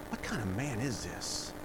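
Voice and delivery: very soft, low wonder; acted amazement, low and quiet; hushed awe. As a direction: drop to an awed near-murmur. Voices disciples in awe after the miracle.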